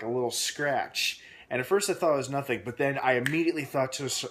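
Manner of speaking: ordinary talk, nothing marked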